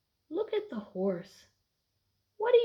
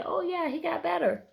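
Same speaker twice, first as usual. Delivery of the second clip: eager model answer; 9 semitones higher than usual. Shown as a demonstration. Teacher models a student's answer.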